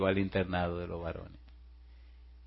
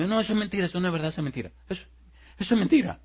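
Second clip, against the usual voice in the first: high-pitched, insistent acted denial; nervous denial; 8 semitones higher, much faster than usual. Nervous man denies the secret to the girl, protesting. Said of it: "se comenzó a poner nervioso".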